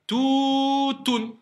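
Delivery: TOUTOUN with first ou held long and high; long vowel, then stop. Shown as a demonstration. Teacher modelling the word for pupils, long vowel then stop. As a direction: stretch "tou", then a short "toun" that stops. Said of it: "Je m'arrête, hein".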